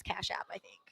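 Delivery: whispered aside. Real, not acted. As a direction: drop to a whisper. Quiet aside to co-host.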